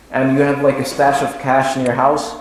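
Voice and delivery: ordinary talk, nothing marked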